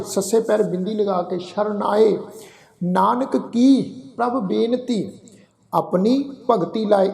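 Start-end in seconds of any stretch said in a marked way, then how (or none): 2.8-7.1 no pause after Nanak, pause after ਕੀ; slow verse with correct pause; pause placement. Shown as a demonstration. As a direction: recite with the break after ਕੀ. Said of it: "ਵਿਸ਼ਰਾਮ ਨਹੀਂ ਦੇਣਾ".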